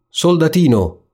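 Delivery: a hint of an impish devil calling out; playful devil calling the soldier. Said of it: "disse il diavolo nero".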